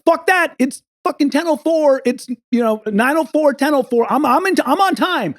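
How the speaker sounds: retold protest, pitched well up; indignant insistence. Traveler disputing the too-late message, indignant insistence. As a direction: argue the clock, worked up in the retelling.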